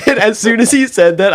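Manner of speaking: high, loud, helpless laughter into words; laughing hard. Real, not acted. Telling a friend about dad's prank, laughing hard. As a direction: barely get the story out for laughing.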